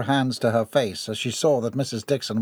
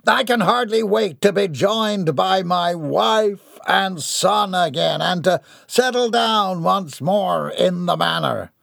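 grand, puffed-up villain speech, higher voice; a self-important tycoon addressing the crowd; pompous villain; 7 semitones higher than usual. A narrator's reading. Gerard boasting to his adoring fans, pompous.

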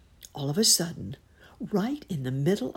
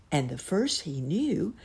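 ordinary delivery, nothing marked

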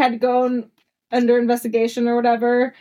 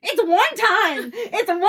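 laughing protest; 9 semitones higher than usual. Real, not acted. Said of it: "laughing high protest".

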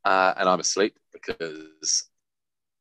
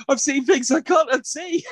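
high, slow, half-laughing wail; laugh-cry it out in mock trauma; a squeaky, tearful mock shout; mock horror. Horrified at the birth.

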